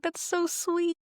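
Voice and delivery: ordinary delivery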